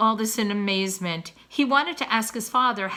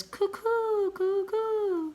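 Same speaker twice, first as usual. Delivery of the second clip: owl call; 12 semitones higher, much slower than usual. Acted. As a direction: hoot like a little owl. Imitating the owl's call.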